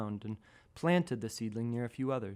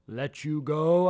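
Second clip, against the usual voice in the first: slow, loud booming giant voice, higher; angry giant; 4 semitones higher, 7 dB louder, slower than usual. Acted. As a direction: thunder down at the tiny seedling like a giant. Giant scolds the trespassing seedling.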